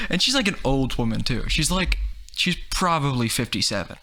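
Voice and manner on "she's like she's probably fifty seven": ordinary talk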